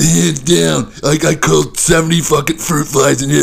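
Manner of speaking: a hint of a boastful online reviewer; lightly mock the overexcited brag; bragging commenter. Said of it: "all the people commenting on it".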